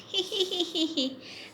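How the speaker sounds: high, tittering acted laugh; naughty parrot laugh. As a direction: giggle like a mischievous parrot. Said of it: "He just laughed".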